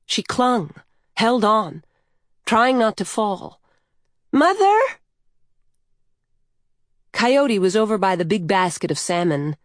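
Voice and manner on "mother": very high, louder rising cry; anxious child's call. A narrator's reading. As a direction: call out for mother as you lose hold. Lost child calls while being swept away.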